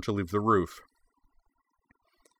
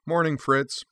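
bright cheerful greeting, higher; 7 semitones higher than usual. Acted. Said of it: "she said cheerfully".

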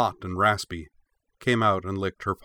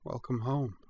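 soft, higher, tender voice; soft welcome; 4 semitones higher, 8 dB quieter than usual. A narrator's reading. Cora welcomes her new friend while hugging her, softly. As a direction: murmur it like a welcome hug. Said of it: "said Cora softly".

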